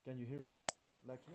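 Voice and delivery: very faint, distant voice; speaking from too far off the mic; barely audible. Real, not acted. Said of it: "but your voice is very low".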